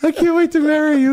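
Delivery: a hint of a blubbering groom, pitched high; lightly hammed-up sobbing groom; mock weepy groom. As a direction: tease the crying groom in a high voice. Mocks crying groom.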